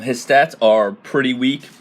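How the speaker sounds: ordinary delivery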